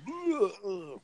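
imitated high, soft groan; slow-motion groan. Recounting a punch shown in slow-mo, mock groan.

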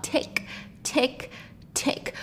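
soft, unvoiced clock ticks; quiet, evenly spaced tick sounds. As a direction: imitate a grandfather clock in hushed ticks. Imitates the grandfather clock ticking.

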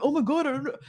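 high-pitched imitation of an overwhelmed partner; mock exasperation. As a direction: throw it up high, mimicking exasperation. Imitates partner pestered by questions.

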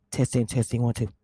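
mic check right on the mic; muffled close-mic testing; too close to mic. Real, not acted. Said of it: "My mouth is on the mic".

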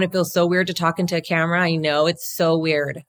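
normal talking voice

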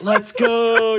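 high, loud drawn-out celebration; excited mock cheer. Player acting out his secret joy at the trade, excited. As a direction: let it burst out, elated and drawn out. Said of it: "was just in pure excitement".

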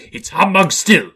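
put-on high, loud, defiant old miser; loud refusal to believe; defiant Scrooge. A narrator's reading. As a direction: burst out in stubborn disbelief. Scrooge defies the approaching noise.

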